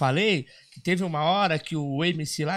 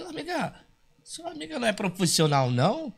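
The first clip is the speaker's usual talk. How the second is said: raised pitch, needling her; mock indignant reply; 7 semitones higher than usual. Dwarf answers girl's friend, high indignant voice. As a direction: poke at her pride.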